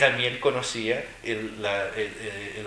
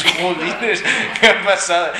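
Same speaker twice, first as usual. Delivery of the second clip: loud, high, laughing jolines; mock kid's dismay, laughing; laughing exclamation; 7 semitones higher, 11 dB louder, slower than usual. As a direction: laugh through a kid's groan of disbelief. Child reacting to punishment.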